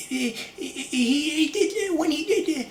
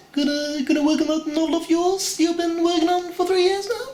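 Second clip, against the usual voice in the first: much higher cartoon baby voice rising into a question; Stewie Griffin; 12 semitones higher than usual. Stewie mocking Brian again, higher and rising. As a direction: taunt it high, ending up on the question. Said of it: "do Stewie Griffin from Family Guy".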